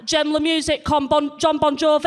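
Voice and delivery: put-on swan in, French with a Leeds accent; broad northern French. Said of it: "she's so northern".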